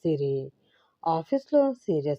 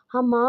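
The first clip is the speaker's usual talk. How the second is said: sharp high cry, pitched up; shriek; 6 semitones higher, 4 dB louder than usual. A narrator's reading. Wife tossed onto the sofa, shrieking. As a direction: yelp as she is thrown. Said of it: "గట్టిగా అరిచింది సిరి".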